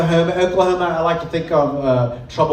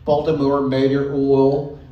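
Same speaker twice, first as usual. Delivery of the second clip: Baltimore accent; 2 semitones lower than usual. Shown as a demonstration. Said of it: "The vowels start to change there".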